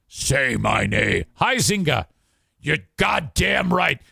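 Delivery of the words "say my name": low slow menace. Shown as a demonstration. Host demanding his name, low and slow. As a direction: Say my name dropped low and drawn out.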